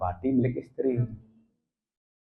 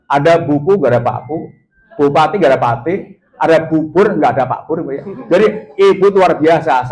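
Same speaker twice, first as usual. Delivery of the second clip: ordinary delivery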